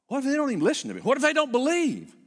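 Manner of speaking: loud, high anguished cry of doubt; loud pleading worry; rising shout. Acted. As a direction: shout out the fear, building. Preacher escalating Moses' fears.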